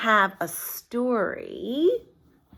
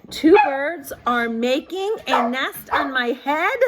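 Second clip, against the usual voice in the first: dismayed elephant; 5 semitones higher, 5 dB louder than usual. Acted. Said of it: "he says".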